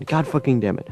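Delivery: ordinary talk, nothing marked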